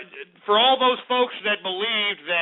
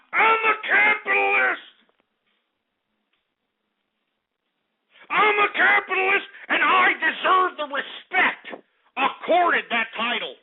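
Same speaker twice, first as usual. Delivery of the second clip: indignant high shout; 10 semitones higher, slower than usual. Acted. Host demanding respect from his chat, a pompous high proclamation. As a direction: announce it as a pompous capitalist.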